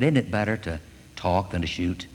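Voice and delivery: calm him down with a low, quiet voice; soothing storybook bear; soft, soothing. Acted. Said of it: "he spoke in a soft, soothing voice".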